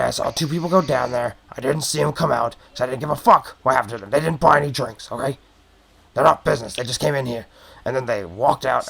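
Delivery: fed-up goblin, talking fast; a grumpy little barman getting it off his chest; irritated goblin barkeep. Acted. Goblin barkeep telling the adventurer about noises, irritated.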